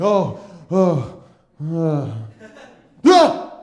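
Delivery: exhausted groans. Acted. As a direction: groan loudly as you collapse, then yelp. Acting out exhausted collapse in alley.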